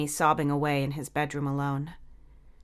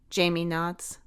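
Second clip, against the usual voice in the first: usual speaking voice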